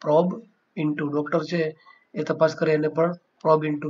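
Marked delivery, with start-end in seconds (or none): 0.0-1.1 word by word. Shown as a demonstration. Teacher repeating a term for investigate, slowly, word by word. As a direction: probe into said very slowly with a gap between the words. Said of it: "તો એને કહેવાય probe into".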